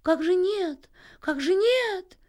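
high-pitched, drawn-out fluster; alarmed thrush. A narrator's reading. Thrush alarmed at being told no, flustered high cry. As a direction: squawk it in startled dismay. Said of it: "всполошился дрозд".

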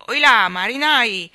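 slow, loud, thundering sailor's greeting; old admiral hailing the children, booming. A narrator's reading. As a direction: boom it across the street. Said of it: "gridò una grossa voce tonante".

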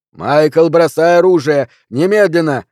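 urgent police command. A narrator's reading. Policeman repeating his order to an armed suspect, loud and high. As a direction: raise pitch and volume to command him.

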